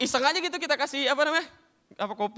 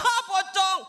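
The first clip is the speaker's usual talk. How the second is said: high startled yelp at a ghost; mock scared cry; 8 semitones higher, 4 dB louder, much slower than usual. Scared onlooker spotting a pocong, mock yelp. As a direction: play the scared normal person.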